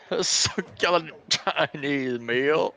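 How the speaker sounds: loud, high, drawn-out theatrical echo; slow mock-grand declamation; imitating arrest-video guy. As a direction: proclaim it with booming mock outrage. Echoing the video's theatrical line.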